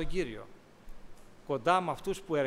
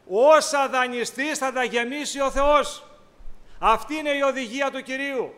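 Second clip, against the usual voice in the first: preaching; 6 semitones higher, 8 dB louder than usual. Acted. Preacher commanding the faithful to forgive richly, loudly. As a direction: drive the command home.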